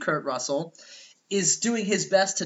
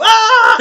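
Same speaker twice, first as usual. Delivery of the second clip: high, loud cheek-slap scream; loud high mock scream; Home Alone scream; 16 dB louder than usual. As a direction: scream like Kevin with hands on cheeks. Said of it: "slap his cheeks and go".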